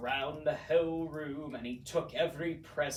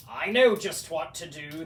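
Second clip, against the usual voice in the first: throaty laugh; 7 dB louder, faster than usual. A narrator's reading. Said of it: "the Grinch laughed in his throat".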